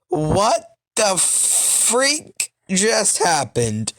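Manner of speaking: drawn-out mock disbelief; slow, incredulous reaction, played up; retold shock. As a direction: stretch each word out in hammed-up shock.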